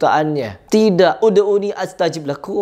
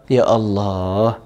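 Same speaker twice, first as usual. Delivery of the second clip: "Ya Allah" drawn out very slowly and much lower, in exasperation; exasperated sigh; 7 semitones lower, much slower than usual. Real, not acted. Commentator despairing at the cleric's teaching, slow and low. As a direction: sink down on "Ya Allah" like you can't believe it.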